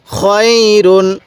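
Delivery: খয়রুন with the "khoy" glide held long; drawn-out recitation. Shown as a demonstration. Teacher modelling the word, খয়রুন slow and drawn out. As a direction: stretch খয় slowly, then close on রুন. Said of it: "এরকমভাবে শিখব".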